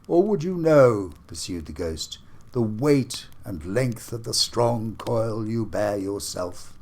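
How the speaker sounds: a booming ghost demanding an answer. Acted. Marley's ghost challenges Scrooge, solemn and booming. Ghost speaks in read story.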